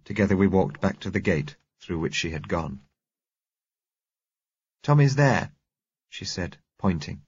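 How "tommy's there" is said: put-on high, louder child's voice; little girl. A narrator's reading. Girl shows driver where Tommy lies, high child's voice. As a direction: point him out in a small girl's voice.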